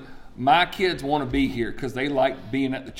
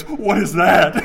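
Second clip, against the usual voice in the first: loud, higher alarmed question; mock fearful; 4 semitones higher, 7 dB louder, slower than usual. Son hearing of grace for the first time, mock-fearful. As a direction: play a scared child, hammed up. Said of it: "He's just fearful of it".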